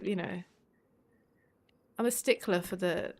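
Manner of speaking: ordinary delivery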